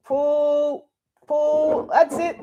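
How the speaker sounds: teacher urging small assistant to pull the heavy blind, high coaxing. Real, not acted. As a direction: cheer her on.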